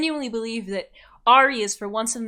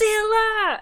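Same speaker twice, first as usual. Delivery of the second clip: high call across the festival; 11 semitones higher, 5 dB louder than usual. Acted. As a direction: shout her name from afar.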